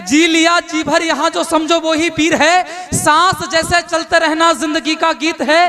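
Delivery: rhythmic declaiming of verse; poem recitation. Acted. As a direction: recite it like a stage poet.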